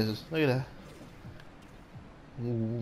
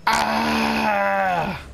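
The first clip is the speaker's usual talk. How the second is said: high, drawn-out "ah" shout; excited yell; 7 semitones higher, 4 dB louder than usual. Real, not acted. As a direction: yell out long and high in the heat of play. Yell during play.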